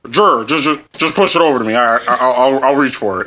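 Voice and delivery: winded, stammering dad voice; mocking dad impression. Son mimics dad too winded to reach, mocking impression. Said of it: "he cannot generate the breath".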